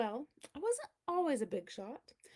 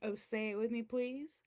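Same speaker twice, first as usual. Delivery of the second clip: ordinary delivery, nothing marked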